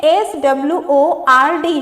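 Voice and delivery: slow letter-by-letter reading; spelling out. Shown as a demonstration. Teacher reading the eighth word's spelling, spelling out. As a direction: spell out each letter slowly. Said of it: "इसमें silent W है".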